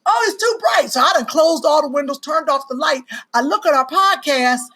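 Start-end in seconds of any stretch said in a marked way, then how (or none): 0.0-0.9 too said high and scornful; mocking high quote. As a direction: squeal it like a whiny complaint. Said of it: "So you told me".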